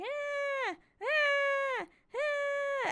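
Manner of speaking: high drawn-out imitated cat wail; screaming cat. Owner imitates her hungry cat seeing her, screaming. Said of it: "like, screaming. It's like".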